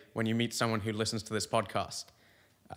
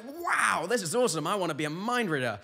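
wide-eyed awe; 6 semitones higher than usual. Retold or imitated. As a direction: gush like an awestruck newcomer, pitch swooping up. Acting out naive awe at lecture.

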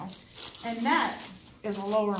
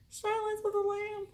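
movie quote; 10 semitones higher than usual. Retold or imitated.

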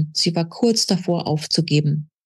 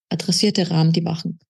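ordinary delivery, nothing marked

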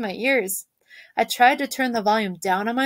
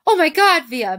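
high, loud excited squeal, easing on the name; loud, high-pitched thrilled cry; screaming with excitement; 7 semitones higher, 6 dB louder than usual. A narrator's reading. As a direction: burst out thrilled for the performer. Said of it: "Mom was screaming with excitement".

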